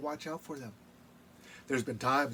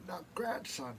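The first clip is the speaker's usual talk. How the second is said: grandma's words; 8 dB quieter than usual. Retold or imitated. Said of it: "she'd go".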